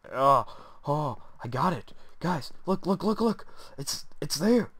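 high, loud excited babble; thrilled, rushing to show the viewers. Real, not acted. Excited, showing viewers the item he found.

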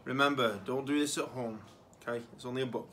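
ordinary delivery

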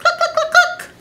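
high, quick clucking; a chicken clucking in the yard; hen clucks. Acted. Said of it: "and the chicken said".

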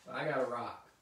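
ordinary delivery, nothing marked

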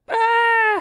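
piercing high horror scream. Acted. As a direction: let out a shrill movie scream. Scream after donor name.

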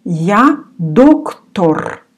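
Я доктор, slow and deliberate; teacher's slow model sentence; slow model reading. Shown as a demonstration. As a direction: say Я доктор slowly for learners. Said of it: "You remember the word доктор".

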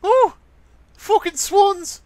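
loud, slow falsetto imitation; mock falsetto outburst; high squeaky voice. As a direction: curse in a very high put-on voice.

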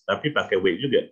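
ordinary delivery

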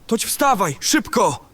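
urgent. Acted. Urgent wake-up call.

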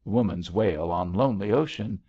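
plain reading voice

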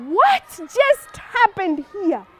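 drawn-out, slow astonished question; stunned crowd. Acted. Storyteller voices people seeing the giant fall, stunned. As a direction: stretch it out in amazed disbelief.

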